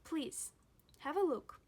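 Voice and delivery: ordinary talk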